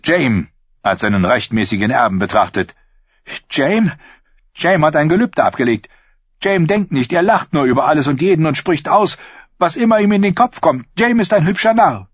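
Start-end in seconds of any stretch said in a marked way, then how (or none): 3.5-12.1 scornful queen, a touch higher; mocking her brother, lightly raised; Cersei voice. A narrator's reading.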